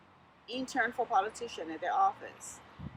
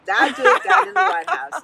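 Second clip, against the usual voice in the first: much higher, very loud, emphatic; loud incredulous exclamation; 11 semitones higher, 14 dB louder than usual. Real, not acted. Urging immigrants to run for office, loud incredulous exclamation. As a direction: burst out in loud, amused disbelief.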